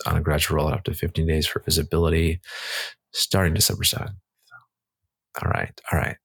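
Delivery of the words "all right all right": low unvoiced drawled all right; breathy low mock McConaughey; Matthew McConaughey. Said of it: "sound like Matthew McConaughey".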